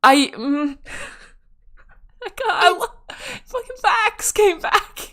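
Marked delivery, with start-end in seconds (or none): 2.2-5.1 high, whispered, tearful squeak; choked up and overcome; real crying. Real, not acted. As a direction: voice gone to a whisper with tears. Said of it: "I'm actually crying".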